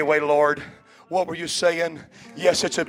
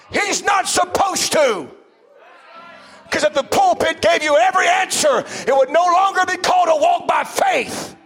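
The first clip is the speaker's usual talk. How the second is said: high, shouted sermon build, slowing on forever; preaching at full force; 11 semitones higher than usual. Acted. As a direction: whoop it to the church like a revival preacher.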